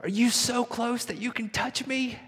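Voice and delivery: put-on high small child's voice, hopeful; little boy asking. Son on his bike asking his father, in a high child's voice. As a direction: ask it like a small boy needing to be sure. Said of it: "Then he says this".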